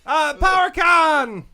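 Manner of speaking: slow, loud PowerCon call; announcer shout. Shown as a demonstration. Opening the toy announcement as a host, loud announcer shout. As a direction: boom it out like a convention host. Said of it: "announcer call".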